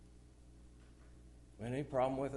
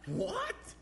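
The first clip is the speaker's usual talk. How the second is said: startled what. Retold or imitated. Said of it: "I said".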